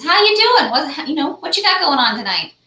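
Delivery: breezy opener from a stranger at a bar; friendly guy at bar. Retold or imitated. Stranger at the bar greeting a newcomer, high breezy mock voice. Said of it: "turns to me and says".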